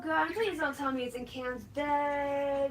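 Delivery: ordinary talk, nothing marked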